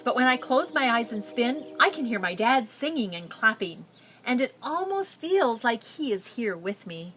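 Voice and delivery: put-on reading a picture book aloud to children; story narration. A narrator's reading. Teacher reading a refugee girl's story to her class, storytelling. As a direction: tell it gently, like reading to a class.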